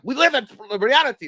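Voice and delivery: imitating a stern father, high and loud; stern dad. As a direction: bark it like a harsh dad. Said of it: "he's like".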